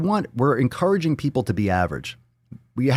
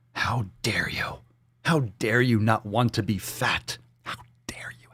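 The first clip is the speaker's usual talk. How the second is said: mock-appalled hush; 2 semitones lower, 5 dB quieter than usual. Podcaster pretending to scold the fatphobic, hushed mock horror. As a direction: sink into a breath of fake outrage.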